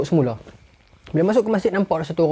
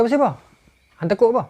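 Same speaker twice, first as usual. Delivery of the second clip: high, louder challenge; demanding; 8 semitones higher, 4 dB louder, faster than usual. Acted. Umar demanding why Hormuzan is afraid, loud and high. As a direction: press him for the reason.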